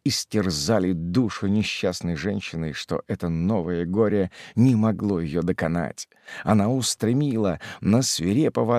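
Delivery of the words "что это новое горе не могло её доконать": ordinary delivery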